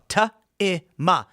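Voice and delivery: "Ta-i-ma" in three separate, punched syllables; sounded out in syllables. Retold or imitated. Coaching her through the name, "Ta-i-ma" syllable by syllable. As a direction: say "Ta-i-ma" piece by piece. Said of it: "And I go".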